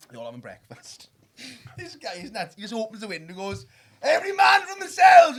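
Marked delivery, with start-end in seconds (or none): 4.0-5.4 high, slow, very loud bellow; hammed-up cry before a wild leap; mock battle-cry shout. Drinker jumping out the hotel window, mock battle-cry bellow. Imitating man leaping from window.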